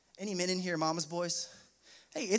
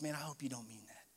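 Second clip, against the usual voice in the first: much softer, lower, hushed and hurt; tearful plea; 6 semitones lower, 11 dB quieter, faster than usual. Retold or imitated. Tearful friend answering his cold words, hushed and hurt. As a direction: say it softly through tears. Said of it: "with tears in her eyes just goes".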